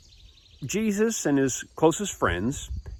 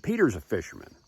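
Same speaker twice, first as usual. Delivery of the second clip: ordinary delivery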